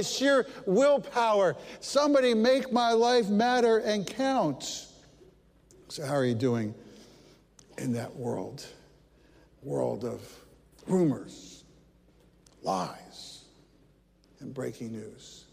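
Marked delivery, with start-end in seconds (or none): 5.9-15.5 much lower and softer, drawn out slowly; quiet refrain. Acted. Preacher asking congregation how they cope, low hushed refrain. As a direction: let the words sink, almost hushed. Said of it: "dramatic repeated refrain".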